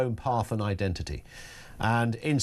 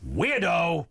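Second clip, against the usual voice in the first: higher, sung-out joke punchline; mock reveal; 6 semitones higher than usual. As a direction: blurt it as a silly fake translation. Joke mistranslation, mock announcement.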